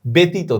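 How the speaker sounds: ব্যতীত as "betito"; e sound. Shown as a demonstration. Teacher illustrates the vowel rule, ব্যতীত with "e". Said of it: "এর মতো হবে".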